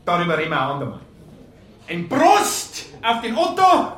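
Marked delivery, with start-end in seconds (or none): 1.9-3.9 higher, louder, slow raised toast. Acted. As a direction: call out the toast to the room. Toast at funeral meal.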